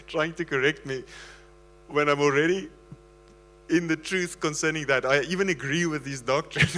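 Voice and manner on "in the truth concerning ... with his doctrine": usual speaking voice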